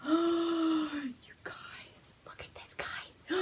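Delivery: hushed, slow whisper of wonder; awed whisper. Real, not acted. Showing viewers her new shawl, a slow awed whisper. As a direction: breathe it like you can't believe it.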